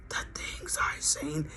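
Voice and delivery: slow, very soft whisper; mock-haunted whisper; shocked whisper. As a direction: hush it like a dazed witness. Imitating Fred, whispered slowly.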